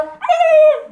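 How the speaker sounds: sudden high yelp of surprise; a sharp high "Ay!" as if suddenly provoked; startled yelp. Retold or imitated. Sudden reaction when provoked.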